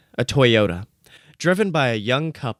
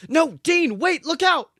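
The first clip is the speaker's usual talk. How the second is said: very high, loud panicked cry; panicked howl; 5 dB louder than usual. A narrator's reading. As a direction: scream a warning at the road. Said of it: "Sam howled".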